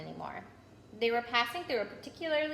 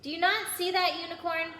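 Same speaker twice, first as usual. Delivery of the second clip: a loud, high, furious challenge; bellowing; 8 semitones higher, 3 dB louder than usual. A narrator's reading. Firenze bellowing at Bane in anger. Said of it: "Firenze bellowed at Bane".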